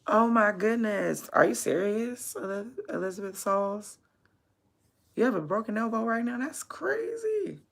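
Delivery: high-pitched real shock, rising to a stretched squeal; shocked disbelief. Real, not acted. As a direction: react aghast to news of a broken elbow. Learns viewer has broken elbow.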